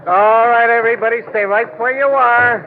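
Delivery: calling out an order to everybody; commanding call to the crowd. Acted. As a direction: halt the whole crowd with one order.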